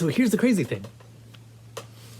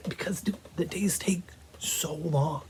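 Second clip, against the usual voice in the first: whisper; 3 semitones higher, 8 dB quieter than usual. Real, not acted. Whispering to viewers about the long game days. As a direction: whisper it, breathy and hushed.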